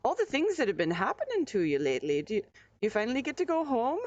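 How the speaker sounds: gentle hostess fussing, quickening; lightly voiced innkeeper's pity; lightly voiced Bertrude. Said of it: "She's like".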